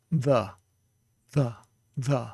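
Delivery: "the" como no inglês; th fricativo em "the"; English th. Shown as a demonstration. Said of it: "like you're saying the word the".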